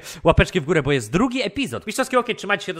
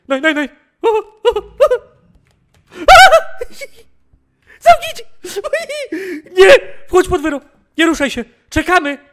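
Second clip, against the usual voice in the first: frantic high shouts for help; panicked yelling; 10 semitones higher, 11 dB louder than usual. Real, not acted. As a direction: yell in total panic. Intruder inside, yelling for police.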